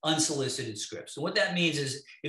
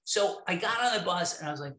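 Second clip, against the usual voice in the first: ordinary delivery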